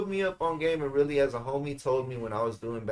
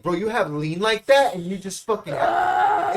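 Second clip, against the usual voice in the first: excited disbelief; 5 semitones higher, 7 dB louder, slower than usual. Retold or imitated. Excited at finding the lean.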